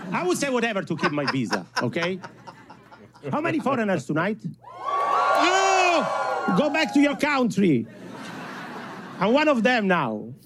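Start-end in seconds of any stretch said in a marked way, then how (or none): 4.8-7.9 high, indignant mock yell; hammed-up high-pitched jeer; mock heckler shout. Comic plays an anti-foreigner shouter.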